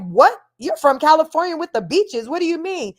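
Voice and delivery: high, loud startled disbelief; shocked kids. Retold or imitated. Classmates reacting to her not swimming, high shocked disbelief. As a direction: gasp it out like they can't believe it. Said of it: "They like".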